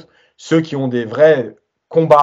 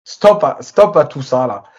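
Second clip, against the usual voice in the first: raised, insistent voice; emphatic stop; 5 dB louder than usual. Real, not acted. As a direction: shout down the nonsense. Fed-up demand to stop.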